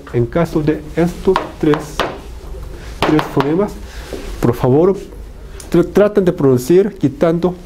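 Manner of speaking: ordinary delivery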